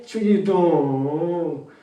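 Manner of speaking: slow, drawn-out Chungcheong drawl; Chungcheong dialect. Shown as a demonstration. Coach showing the comforting regional version, Chungcheong drawl. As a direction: stretch the ending lazily in Chungcheong style. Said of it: "약간 충청도 버전이".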